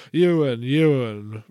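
loud weary sigh of a name. A narrator's reading. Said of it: "Robbo sighs".

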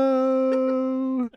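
high, drawn-out announcer call; hammed-up commentator name call; mock announcer. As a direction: stretch it out like a ring announcer. Impersonating commentator naming match.

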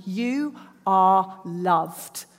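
slow, weighted, every word leaned on; emphatic declaration. Shown as a demonstration. Preacher to congregation, declaring it slow and weighty. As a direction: let each word land, slowly. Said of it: "that just says very loudly".

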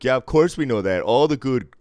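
loud, high mock-Canadian retort; Canadian accent. Canadian fans boasting of hockey players, loud imitated Canadian accent. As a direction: fire back as an imitated Canadian fan. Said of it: "And they were like".

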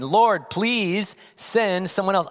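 high, loud, slow begging plea; pleading Moses. Retold or imitated. Moses begging God to send someone else, loud slow plea. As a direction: whine it up to God like a desperate man. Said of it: "Moses pleaded again".

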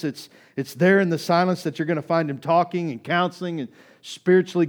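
loud, high, urgent sermon delivery; impassioned preaching. Acted. Preacher to congregation on knowing the desert, impassioned. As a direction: preach it hard, high and forceful.

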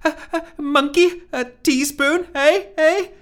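very high, loud nervous jest; squeaky questioning ice-breaker; awkward joking. Acted. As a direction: nudge for a laugh, high and eager.

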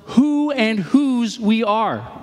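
slowed, weighty reading of the line; slow, deliberate scripture-style delivery; slow emphatic quote. Shown as a demonstration. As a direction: lay each word down slowly. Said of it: "He says this".

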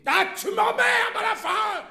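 very high, loud furious shout; exploding in anger. A narrator's reading. Wife exploding at her stammering husband, furious shout. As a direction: burst out, at the end of all patience.

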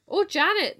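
high, loud cry of surprise; surprised cry. A narrator's reading. Farmer alerting his wife, surprised cry. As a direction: call out to your wife, astonished. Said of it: "cried out in surprise".

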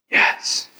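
a barely voiced, breathless yes; whispered yes. Man at altar whispers yes to pastor, hushed whisper. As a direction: answer in a hushed whisper, head down. Friend answers at altar call.

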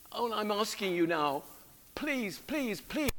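high, soft, desperate begging; praying as David. Acted. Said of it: "as if you were praying it for yourself".

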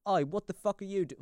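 put-on botched Australian accent; failed Australian accent. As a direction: attempt an Aussie guard and land on British. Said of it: "I went British".

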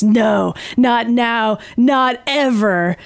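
dramatic Logan line. Retold or imitated. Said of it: "such a Logan line".

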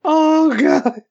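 breathless from laughing; catching breath after a laugh fit; after laughing hard. Real, not acted. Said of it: "I'm laughing so hard".

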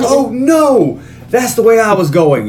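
high, hammed-up fake surprise; a hint of hammy fake surprise; mock surprise. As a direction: overact the "oh no" like a bad actor. Mocking bad-actor delivery.

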